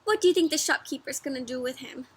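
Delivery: usual speaking voice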